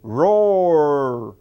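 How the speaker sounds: loud growling monster roar; dinosaur roar. Pretend. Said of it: "I thought that was very convincing".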